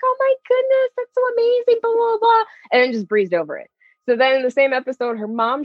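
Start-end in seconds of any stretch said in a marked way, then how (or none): 0.0-2.4 very high, squealy imitation of delight; mock gushing. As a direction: gush it in a far higher put-on voice. Voices friend's delighted reaction.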